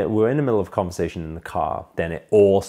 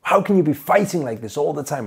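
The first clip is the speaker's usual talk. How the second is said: raised, much higher angry dad voice; mock the furious father demanding answers; father yelling at his teenage son; dad's angry retort; 9 semitones higher, 6 dB louder than usual. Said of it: "and then he says".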